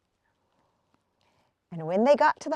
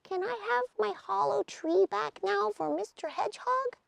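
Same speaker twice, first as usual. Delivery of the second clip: high, softer little lizard voice; 11 semitones higher, 6 dB quieter than usual. A narrator's reading. Lizard asking for the hollow tree back, high soft voice. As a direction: ask meekly like a tiny lizard.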